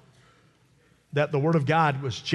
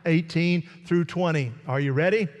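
raised, fervent preacher voice; preaching; 8 semitones higher than usual. Acted. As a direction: preach it with full force. Preaching at full force.

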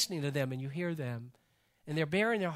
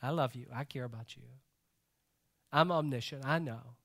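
gentle Jesus voice; 6 semitones lower, 5 dB quieter than usual. Acted. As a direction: lower and softer, tender and reassuring. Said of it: "And he says".

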